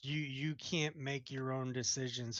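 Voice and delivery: ordinary talk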